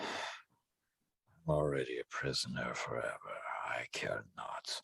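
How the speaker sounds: low, soft, cold entity voice. Acted. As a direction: speak low and uncaring.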